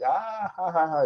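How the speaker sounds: high-pitched mock laughter; imitated hard laugh. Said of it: "they'll laugh pretty hard".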